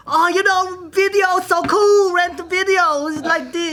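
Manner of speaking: high, slow mock Chinese accent voice; hammed-up Chinese accent pitched high; fake Chinese accent. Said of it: "I felt like a fucking asshole".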